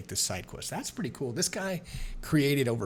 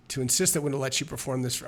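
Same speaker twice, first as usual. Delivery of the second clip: usual speaking voice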